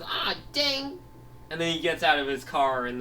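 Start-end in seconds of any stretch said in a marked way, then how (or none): 0.0-1.0 high-pitched cry of dismay; dismayed exclamation. Acted. Character reacts to causing a pile-up.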